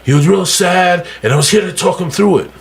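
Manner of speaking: high, showy bragging voice, pitched well up; mock bragging. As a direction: ham up a show-off posting about his good deeds. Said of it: "So what I would do is I go".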